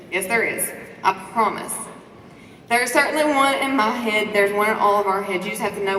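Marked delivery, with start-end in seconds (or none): none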